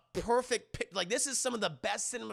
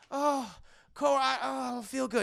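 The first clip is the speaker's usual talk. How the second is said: higher-pitched woozy complaint; imitated feeling faint; 5 semitones higher than usual. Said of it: "exhausts himself 'cause he has really low blood sugar".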